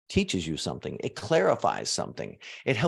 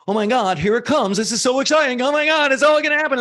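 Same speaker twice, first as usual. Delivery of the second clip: higher and louder, building to giddy glee; mock excitement; 11 semitones higher, 7 dB louder, faster than usual. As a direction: play the over-eager believer getting carried away. Acting out an over-eager manifester.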